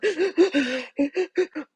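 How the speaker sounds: sobbing gasp. Retold or imitated. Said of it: "It's like the whole, whole".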